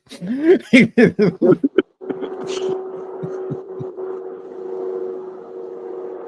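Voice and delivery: high, drawn-out laughing fit; long laugh. Real, not acted. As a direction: crack up at your own joke. Cracks up at own joke.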